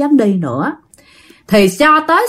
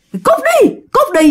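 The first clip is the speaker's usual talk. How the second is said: chasing off; 14 semitones higher than usual. Acted. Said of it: "giống như đuổi cái con nhỏ kia".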